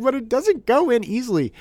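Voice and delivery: very high mock kid whine; upset child. Dad imitating his upset son over the eraser, high whine. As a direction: imitate a tearful little boy complaining. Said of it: "he's crying like somebody ran over his dog".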